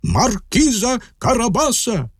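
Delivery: high, emphatic announcement; reapers answering in chorus. A narrator's reading. Reapers answer the king in one voice, high chorus-like reply. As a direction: ring it out like a group reply. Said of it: "відповіли жінці в один голос".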